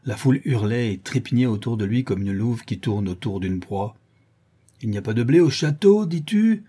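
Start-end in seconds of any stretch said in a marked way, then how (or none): 4.8-6.6 loud question climbing high at the end; challenging cry. A narrator's reading. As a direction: shout the challenge over the riot. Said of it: "s'écria le charron". (interpellation criée, puissante)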